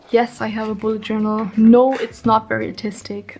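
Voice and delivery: ordinary talk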